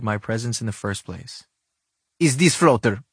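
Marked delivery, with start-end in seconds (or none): 2.2-3.0 put-on louder, higher creepy villain voice with Russian accent; creepy Bond villain. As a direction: sound like a menacing Bond villain. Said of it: "sound like a creepy James Bond villain".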